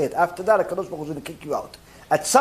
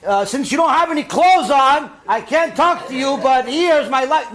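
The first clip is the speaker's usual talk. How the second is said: high-pitched mock rebuke; demonstrating a rude, raised-voice brush-off; rude address; 5 semitones higher than usual. Shown as a demonstration. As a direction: scold a stranger, pitched up and tactless. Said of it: "'Cause you cannot simply say".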